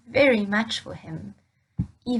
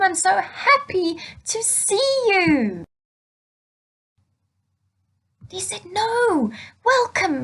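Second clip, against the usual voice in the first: overjoyed father, much higher and bubbling; excited father; 8 semitones higher, 4 dB louder than usual. Acted. Father greeting his lost son, high and overjoyed. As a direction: gush over the son who came home. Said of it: "He was so excited".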